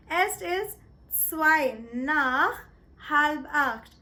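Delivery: slow careful German, each word spaced out; "Es ist zwei nach halb acht" said slowly, word by word; German time phrase. Shown as a demonstration. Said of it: "you're going to say".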